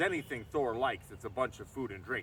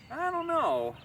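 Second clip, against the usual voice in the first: high, louder doubtful giant; giant king musing; 6 dB louder, slower than usual. Acted. Thrym doubts his bride, thinking aloud.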